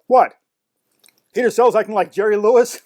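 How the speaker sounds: high, loud echo of the owner's scoff; mock scornful disbelief. Narrator teasing the owner with own words, mock outrage. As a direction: throw the words back in feigned disgust.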